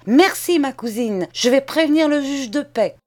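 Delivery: high, loud defiant parting shot; crushing retort. Acted. Charles throws a crushing threat at his cousin while fleeing. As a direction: fling the threat back as you run. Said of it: "cette phrase foudroyante".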